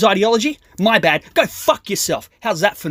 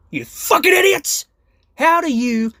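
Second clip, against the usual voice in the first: furious shout; 10 semitones higher than usual. Real, not acted. Exasperated outburst at leftists.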